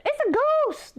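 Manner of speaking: high, frightened cry of "ghost". Retold or imitated. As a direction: shriek it out in sudden fright. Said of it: "they said, and they cried out in fear".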